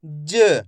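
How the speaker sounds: ज as a short juh, no aa; half-sounded letter. Shown as a demonstration. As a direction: cut the vowel of ज down to a brief uh. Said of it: "last वाले अक्षर का उच्चारण अपन आधा करेंगे".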